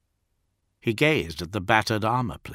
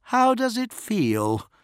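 much higher, slow and gloating; mocking taunt; 13 semitones higher, slower than usual. A narrator's reading. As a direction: draw out the cruel question. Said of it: "The swordsman smiled and asked".

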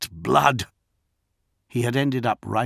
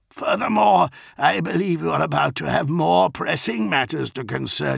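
high, raised declamatory voice; Dantiok; 7 semitones higher than usual. A narrator's reading. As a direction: call out the warning with formal defiance. Dantiok defiantly answers the villain.

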